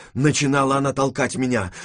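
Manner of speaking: ordinary delivery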